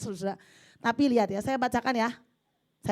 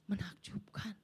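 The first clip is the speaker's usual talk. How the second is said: hushed, breathless whisper of wonder; awed whisper; 13 dB quieter, slower than usual. Real, not acted. Preacher to congregation, amazed, in a whisper. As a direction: breathe it out in awe.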